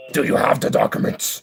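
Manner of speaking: forceful, theatrical grilling; mock interrogation. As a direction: demand it in a harsh put-on voice. Repeats question in put-on voice.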